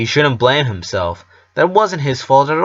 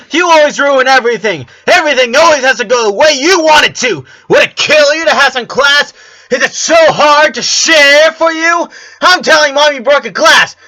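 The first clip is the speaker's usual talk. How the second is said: put-on high, loud child screaming match; shrill kids shouting each other down; screaming twins; 8 dB louder than usual. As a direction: shriek it in her sister's face. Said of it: "screaming right into each other's faces".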